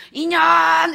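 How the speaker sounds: put-on high, loud drunken yell of her name; drunk bellow. Drunk father bellowing at his wife, a loud raging yell.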